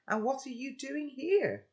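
put-on girl pressing the intruder again; insistent girl. A narrator's reading. Girl repeats demand.

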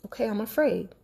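quiet, gentle self-admission; hushed admission to herself; soft admission. Retold or imitated. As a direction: say it softly to yourself. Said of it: "I'm sitting there".

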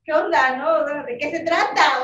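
teacher complaining to the mother; indignant teacher. Retold or imitated. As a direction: scold the mother in disbelief. Teacher confronts exercising mom.

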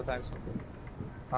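slow soft whisper of thanks मत बोलो; hushed mock-scolding aside; whispered repeat. Real, not acted. Streamer repeats it, dropping voice.

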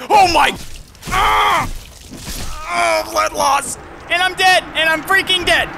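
high, loud shocked outcry; shocked yelling. Real, not acted. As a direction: cry out as you die. Dies suddenly, shouting.

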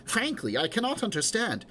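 mildly aggrieved, demanding fairness; lightly voiced indignant old dame. Old aunt demands the same deal from Nisbet, mildly indignant.

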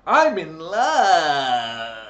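high, loud, very slow crying wail; hammed-up swooning sob; mock lovestruck. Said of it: "I never go".

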